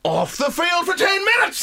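triumphant referee verdict; mock referee shout. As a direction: bellow the sin-bin call. Voicing referee sending off.

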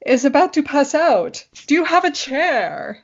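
high, drawn-out English cry for help; mock-alarmed British accent, slowly stretched; John the Brit. Fiance calling out as she nearly faints, alarmed British accent. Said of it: "I also had John the Brit".

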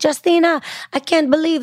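imitating her Jamaican banker's accent; light Jamaican accent, high and bright. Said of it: "She has a super thick, um, Jamaican accent".

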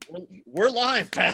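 laughing through the words; giggling while talking. Real, not acted. Both laughing after glitch.

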